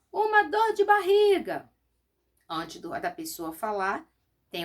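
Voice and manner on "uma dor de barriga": much higher, louder, slow shouted answer; shout from the back. Acted. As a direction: yell it from the back of the classroom. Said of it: "alguém grita". (aluno grita do fundo da sala, bem alto)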